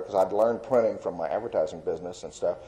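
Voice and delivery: ordinary talk, nothing marked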